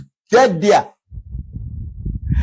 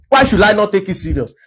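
ordinary talk

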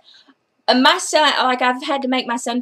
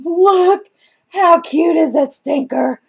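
high, louder squeal; slow delighted coo at the dog; excited cooing; 5 semitones higher, 4 dB louder, slower than usual. Real, not acted. Showing cute dog picture.

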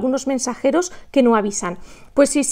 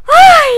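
loud, very high "ay!"; squeal, then burst out laughing; high yelp breaking into laughter; embarrassed shriek and laugh; 6 dB louder than usual. Real, not acted. Shriek after her own singing.

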